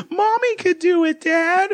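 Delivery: put-on high, piping little-girl voice; mock child's voice teasing her dad; little daughter. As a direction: chirp it like a smug little girl. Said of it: "the daughter straight up goes".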